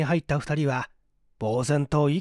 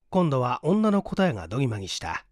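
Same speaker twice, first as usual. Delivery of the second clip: ordinary delivery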